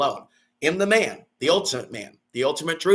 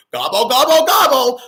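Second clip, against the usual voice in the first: high, loud gobbling noise; mocking gobble; 9 semitones higher, 8 dB louder than usual. Real, not acted. Mocking people stuffing themselves, loud gobble. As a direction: mimic greedy gulping.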